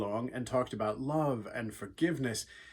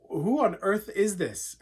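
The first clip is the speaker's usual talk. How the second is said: startled question; 6 semitones higher, 4 dB louder than usual. Retold or imitated. Saul startled by a voice.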